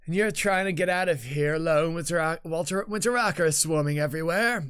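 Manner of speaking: acted defiant questioning; indignant game heroine. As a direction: challenge them hotly, in character. Voicing Marut's angry argument.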